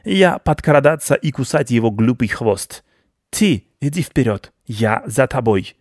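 swaggering rodent in command; proud rat baron. Acted. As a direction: give orders boastfully in broken speech.

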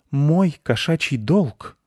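high, slow, haughty pronouncement; stern. A narrator's reading. Masha the cat sternly declares her feline duty. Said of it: "строго сказала Маша-кошка".

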